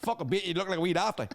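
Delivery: hammed-up Ballymena hard man swagger; Ballymena fighter impression. Fighter trash-talking UFC opponents, in a Ballymena accent, hammed up. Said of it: "that's a pretty good Reece impersonation".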